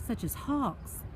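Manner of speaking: ordinary delivery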